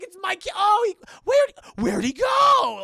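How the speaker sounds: high, loud, frantic yelling; frantic mother screaming; panicked shouting. Retold or imitated. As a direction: shout in panic as the child disappears. Mother panics, kid vanished.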